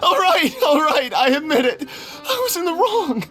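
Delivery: high, whimpering surrender; tearful admission. Acted. Cornered man confesses his wrong to his captor, tearful admission. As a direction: give in with a sob in the voice.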